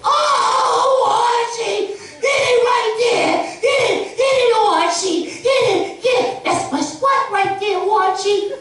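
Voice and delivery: Edith in bed. Retold or imitated. Edith urging Archie on in bed, shrill falsetto. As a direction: squeal high like a hammed-up Edith. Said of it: "you got Edith".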